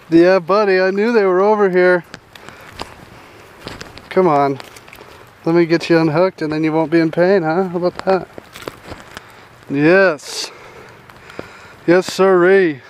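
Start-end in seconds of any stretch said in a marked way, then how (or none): none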